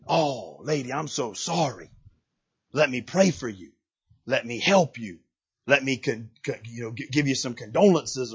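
mock tender condolence; sympathetic pastor. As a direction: hush down like a gentle pastor. Imagined pastor voice consoling widow.

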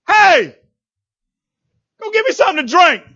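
mock demanding traveler; demanding Elijah. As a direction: boss her around like a rude stranger. Elijah voiced demanding water.

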